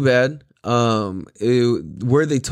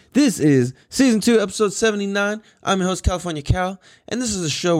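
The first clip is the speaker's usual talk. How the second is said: higher announcer voice for the intro; hyped host intro; 7 semitones higher than usual. Acted. Host introduces himself to listeners, hyped. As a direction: sell the show like a radio host. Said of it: "host announces episode".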